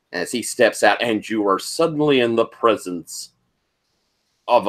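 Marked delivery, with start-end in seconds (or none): none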